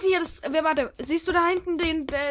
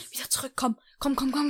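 ordinary talk, nothing marked

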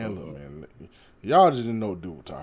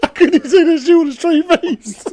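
laughing hard through the words; can barely get it out for laughing; 7 dB louder than usual. Real, not acted. Laughing while talking.

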